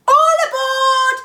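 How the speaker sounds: a long, slow call, pitched high and loud; cried out. Acted. Conductor calling the children aboard, long high cry. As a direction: sing it out down the platform. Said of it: "the conductor cried out".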